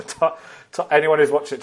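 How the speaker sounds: normal talking voice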